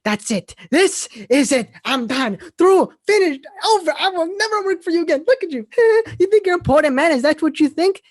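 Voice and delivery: loud, high-pitched quitting tirade; hammed-up sitcom meltdown, punching each phrase; George. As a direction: build from firm to full-voiced ranting, word by word. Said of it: "try to do my best to reenact it".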